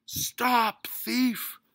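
huge high-pitched bellow. A narrator's reading. Mister Grumby running after his stolen roast, bellowing. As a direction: bellow at a fleeing robber. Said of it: "bellowed Mister Grumby".

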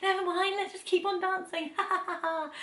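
put-on high-pitched, carefree drunk squeal trailing into laughter; giddy drunk. Imagining being drunk after twisting an ankle, giddy high squeal. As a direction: squeal it like a tipsy party girl. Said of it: "if you were super drunk".

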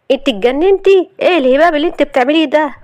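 high-pitched outburst, speeding up; angry. Acted. Husband dodging wife's vase, furious. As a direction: rush out the outraged challenge. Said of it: "واسودت عينيه من الغضب".

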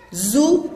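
"زهور" broken into zu, then hūū held long; long vowel. Shown as a demonstration. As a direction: stretch the second syllable of زهور. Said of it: "إنها ضمة طويلة".

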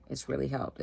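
normal talking voice

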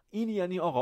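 ordinary talk

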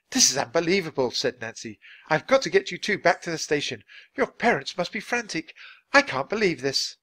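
a touch of flustered disbelief; dismayed Nancy. Acted. Nancy, dismayed at finding kids.

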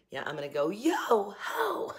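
daughter's goofy voice. Retold or imitated. Daughter jokes about walking down the aisle, goofy slow voice. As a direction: ham up the daughter joking about the aisle song. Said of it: "So my daughter goes".

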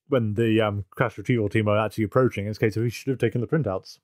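ordinary talk

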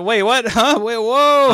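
loud, high yelps of confusion; acted bewilderment. Son retelling his mother's mix-up, loud bewildered shock. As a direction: reel in exaggerated disbelief. Acts out reeling at mother's mistake.

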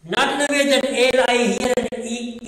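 ordinary delivery

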